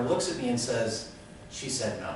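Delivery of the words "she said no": low, quiet deadpan; deadpan refusal. Retold or imitated. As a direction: deliver the verdict softly and dryly. Cashier returns with manager's answer.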